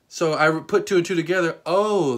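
ordinary delivery

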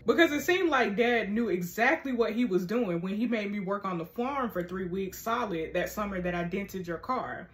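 ordinary talk, nothing marked

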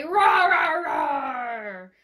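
loud, high roaring dinosaur. Pretend. Teacher roaring like a dinosaur for the class, loud. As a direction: roar it out big for the kids. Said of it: "our dinosaurs are gonna say".

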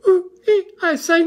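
high, loud panicky squeals; Piglet. A narrator's reading. Piglet jumps about as Roo is swept off, squealing. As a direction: squeal little noises in a flap. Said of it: "jumping up and down and making".

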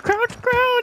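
high urgent yell, crouch!; urgent shout. Real, not acted. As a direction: shout it at your teammate. Urgent callout in firefight.

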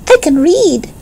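put-on little boy proudly announcing; proud child. A narrator's reading. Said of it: "remarked Benny".

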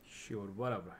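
dismissive. Real, not acted. Skipping through game NPC dialogue.

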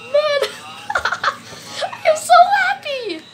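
high squealing laugh into happy words; giddy high-pitched delight; gleeful laughter. Real, not acted. Delighted house survives fireball.

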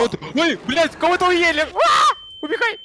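high loud panicked cries; panicked shouting. Real, not acted. Someone caught, urges escape.

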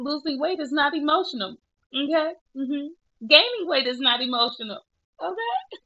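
steady yourself through tears; holding back tears. Real, not acted. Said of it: "I can't even get through a sentence without crying".

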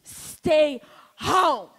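barked mock command to stay home; mock order. Comic mock shout ordering women to stay home. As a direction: shout it like a smug man.

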